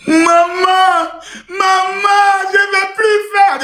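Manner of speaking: high, drawn-out mock wail; crying child. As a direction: plead with mum like a hammed-up tearful child. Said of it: "Et quand l'enfant sort". (enfant qui pleurniche fort, voix aiguë)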